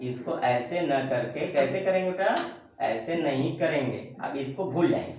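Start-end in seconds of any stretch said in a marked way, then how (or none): none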